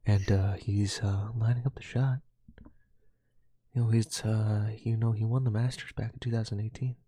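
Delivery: hushed, low golf commentator voice; golf announcer. Pretend. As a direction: murmur like a TV golf broadcaster. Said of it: "Just do my golf announcing the entire time".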